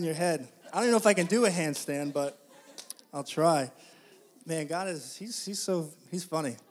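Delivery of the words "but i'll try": ordinary delivery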